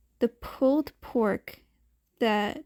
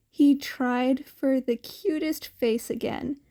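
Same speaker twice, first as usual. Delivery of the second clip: ordinary delivery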